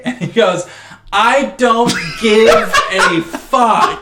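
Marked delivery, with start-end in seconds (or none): 1.1-4.0 slow, loud, high shout; mock exasperated outburst. Imitating dad's escalation at the boys, slow shout. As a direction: hammer out each word, exasperated. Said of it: "like, it escalates".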